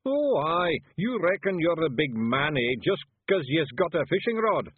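high, loud Scots taunting; belligerent Feegle. Acted. Daft Woolly taunting a garden gnome, belligerent. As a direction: square up for a scrap.

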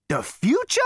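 loud, high rising echo; incredulous exclamation. A narrator's reading. Zaphod echoing the elevator, incredulous exclamation. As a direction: exclaim it in disbelief. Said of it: "exclaimed Zaphod".

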